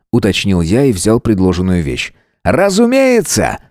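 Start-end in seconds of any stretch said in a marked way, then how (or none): 2.4-3.6 higher showman announcer voice; ringmaster. Acted. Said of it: "кивнул тот".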